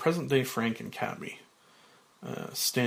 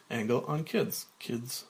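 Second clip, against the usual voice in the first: ordinary delivery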